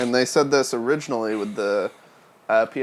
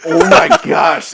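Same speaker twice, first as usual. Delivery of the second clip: loud, slow, laughing delight; 8 semitones higher, 11 dB louder than usual. Real, not acted. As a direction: laugh through it. Laughing at joke idea.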